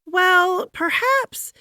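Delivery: very high, lilting "perhaps"; musing wife. A narrator's reading. First Lady musing over her stuck husband. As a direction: drift off thoughtfully, high.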